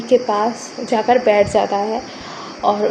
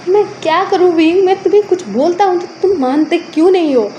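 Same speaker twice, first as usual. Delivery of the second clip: higher-pitched, exasperated scolding; glaring reproach; 4 semitones higher than usual. Acted. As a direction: scold him in helpless frustration. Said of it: "घूर के देखता है". (झुंझलाकर डाँटते हुए)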